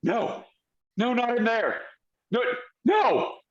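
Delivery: high, small panicked cries, climbing; small creature's high-pitched terror, rising to a shriek; small voice screaming. Acted. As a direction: plead in a tiny, acted scream.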